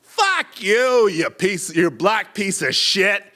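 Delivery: put-on high, furious drunk insult; drunk slur yell. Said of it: "I hear".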